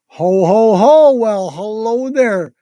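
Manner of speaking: hearty ho ho ho then gentle hello; kind jolly greeting. Acted. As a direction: greet the child kindly, with a big laugh. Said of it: "Santa said kindly".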